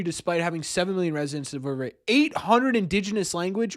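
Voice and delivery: ordinary delivery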